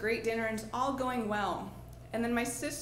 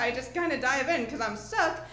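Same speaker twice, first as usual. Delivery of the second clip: usual speaking voice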